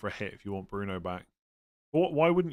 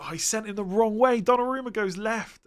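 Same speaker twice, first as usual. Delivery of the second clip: excited replay; 10 semitones higher, 5 dB louder than usual. Retold or imitated. Reliving a penalty miss.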